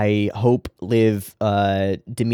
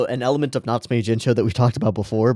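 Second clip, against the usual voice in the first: ordinary talk, nothing marked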